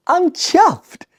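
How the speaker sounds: high-pitched delighted exclamation; really pleased. Shown as a demonstration. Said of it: "you are really pleased".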